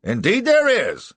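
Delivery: high, loud cry; indignant judge exclaiming. A narrator's reading. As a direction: burst out in agreement. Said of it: "cries the judge".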